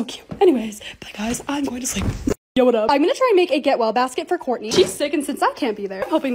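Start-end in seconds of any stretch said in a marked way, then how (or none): 0.0-2.0 real soft whisper saying goodbye; sleepy voiceless goodbye. Real, not acted. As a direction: whisper the sign-off. Sick, signing off for sleep.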